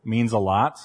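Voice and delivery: ordinary talk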